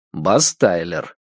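plain reading voice

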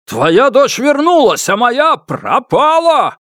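very high, drawn-out loud accusation; desperate shout. A narrator's reading. Father compares the missing and returned daughters, desperate shout. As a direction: shout it slowly in anguished anger.

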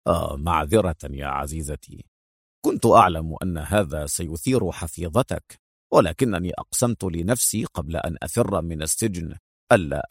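low, soft apology; tender. A narrator's reading. Agent apologizing to his hurt partner, low and tender. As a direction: soothe her gently, voice dropped. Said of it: "ربت على كفها في حنان وهو يقول".

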